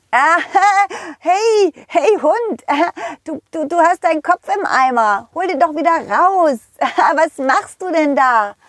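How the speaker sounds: put-on high, giggly boy's voice; amused little pirate. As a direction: tease the dog as a laughing child.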